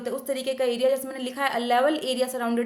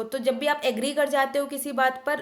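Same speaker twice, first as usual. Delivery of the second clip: normal talking voice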